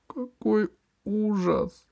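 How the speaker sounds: high, slow horrified gasp; gasp of horror. A narrator's reading. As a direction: gasp it out in shock. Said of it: "ахнула Алиса".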